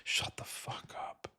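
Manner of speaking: whispered shut up. Acted. Shutting up Republican congressmen in a whisper. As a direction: whisper the retort.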